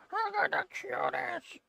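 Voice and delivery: squeaky high and hushed; high soft coo. Real, not acted. To the cute cats, a high hushed coo. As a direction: croon it way up high.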